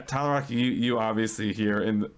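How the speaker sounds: ordinary delivery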